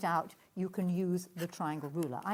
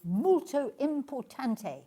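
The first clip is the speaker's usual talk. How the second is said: fake Italian accent; 7 semitones higher, 5 dB louder, slower than usual. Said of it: "That sounds like somebody pretending to speak Italian".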